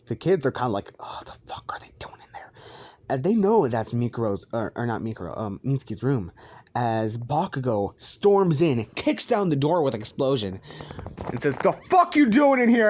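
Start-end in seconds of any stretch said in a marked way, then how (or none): 1.0-2.5 hushed, quick whisper; whispered wondering. Acted. Kids whispering about the noises in there, hushed. 11.6-12.9 loud, high outraged demand; angry bursting-in shout. Acted. Bakugo bursting into the room, shouting angrily. Bakugo storms in, kicking door.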